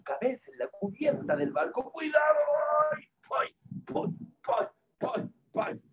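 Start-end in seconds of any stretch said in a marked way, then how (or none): 1.9-2.4 high shout of warning; warning cry. Acted. Warning as hunter falls. 2.9-5.8 string of short pretend ouches; comic yelps of pain, one per bump; play-acted ow at each knock; repeated mock yelps. Hunter hits his head repeatedly.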